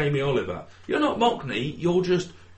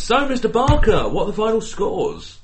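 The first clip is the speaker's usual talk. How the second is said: schoolteacher; 7 semitones higher, 4 dB louder than usual. Acted. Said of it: "getting told off here in the class".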